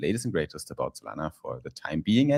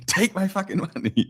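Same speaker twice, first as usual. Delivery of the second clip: laughing mock plea; 13 semitones higher than usual. Mock customer pleading, laughing.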